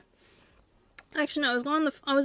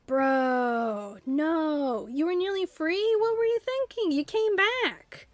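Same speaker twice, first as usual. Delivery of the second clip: much higher, slowing incredulous scold; 3 semitones higher, slower than usual. Pretend. Owner scolding the object that came back, high and incredulous. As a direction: scold a buddy who blew it, in disbelief.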